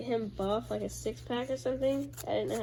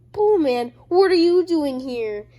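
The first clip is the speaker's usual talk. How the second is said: higher and much louder startled call; mock surprise; 6 semitones higher, 11 dB louder than usual. Mamble shocked to see Bullman, loud startled cry. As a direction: shout it like you can't believe he's here.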